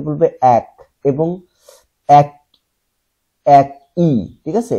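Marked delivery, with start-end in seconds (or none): 2.1-4.1 একই broken into æk and i; word split in parts. Shown as a demonstration. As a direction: say এক, pause, then ই. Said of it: "এটা আমরা বলবো একই".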